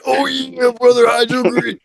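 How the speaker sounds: high wrestler catchphrase, drawn out; imitating a pro wrestler; Macho Man. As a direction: growl it like a ring promo. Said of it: "that was Macho Man".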